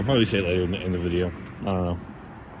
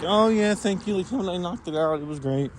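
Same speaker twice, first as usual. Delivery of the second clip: high-pitched retold gush of thanks; light mock-bashful boasting; pleased thanks; 9 semitones higher than usual. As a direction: play up being chuffed by praise, voice lifted high. Retelling own pleased reply to praise.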